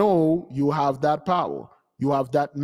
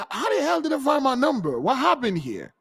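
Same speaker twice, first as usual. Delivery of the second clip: baffled quote; 8 semitones higher than usual. Retold or imitated. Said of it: "We're like".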